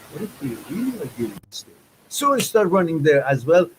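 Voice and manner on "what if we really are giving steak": quiet musing. Retold or imitated. Character doubts to himself.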